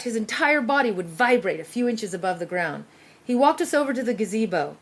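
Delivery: ordinary delivery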